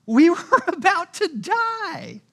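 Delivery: high, loud, laughing disbelief; exasperated. Retold or imitated. Preacher answering Jesus's question, laughing and exasperated. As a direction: blurt it out half-laughing at the obviousness.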